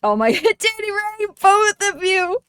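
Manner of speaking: high, loud laughing cry at the chat; laughing outburst. Real, not acted. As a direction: squeal it out through laughter, caught off guard. Reacting to cheeky chat comments.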